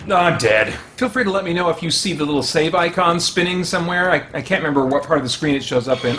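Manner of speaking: ordinary delivery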